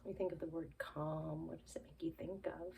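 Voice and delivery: quick soft whisper. Acted. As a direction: whisper the question. Calming children.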